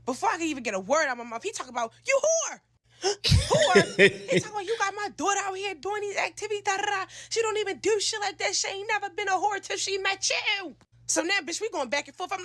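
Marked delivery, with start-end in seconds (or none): 2.0-4.3 mock-outraged high exclamation; outraged father. Retells father's insult. 4.7-10.8 high-pitched mock-indignant dad tirade; outraged father rant. Retells father's angry rant.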